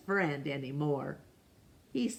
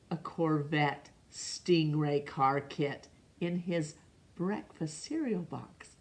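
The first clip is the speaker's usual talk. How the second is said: usual speaking voice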